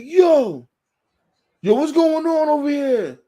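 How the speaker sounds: worked-up, high-pitched retold outburst; spazzing supervisor. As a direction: imitate a boss flying off the handle.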